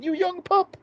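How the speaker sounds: very high mock yell, held back in volume; imitated yell. Repeating his first words to Paul, high mock yell. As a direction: squeal it like a contained yell. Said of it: "That's what he's yelling".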